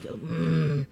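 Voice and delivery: low, quiet sulky "mm"; imitated grumpy grunt. Imitating co-host's answer to the instruments, sulky grunt. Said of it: "you're like".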